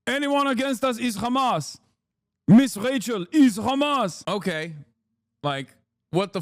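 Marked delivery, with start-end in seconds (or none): 0.1-4.2 higher, hammed-up accusation in Israeli accent; mock Israeli spokesman. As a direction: declare it with parody accented indignation. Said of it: "they're constantly like".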